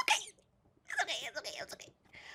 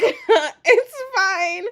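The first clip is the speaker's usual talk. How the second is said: laughing; 10 semitones higher, 6 dB louder than usual. Real, not acted. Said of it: "It's not fine".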